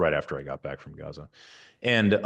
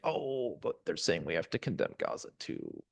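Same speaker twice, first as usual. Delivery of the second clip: soft, dismayed mimicry of reluctant people; mock reluctance; 9 dB quieter than usual. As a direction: say it like a hesitant objector, trailing down quietly.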